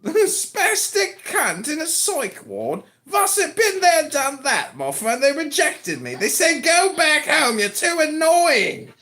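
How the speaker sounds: high, hammed-up British complaint; put-on British accent. British comic tells of being rejected, high mock British accent.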